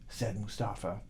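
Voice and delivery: plain reading voice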